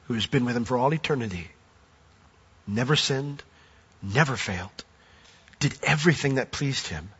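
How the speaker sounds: hushed, solemn. Real, not acted. Solemn climax about the cross.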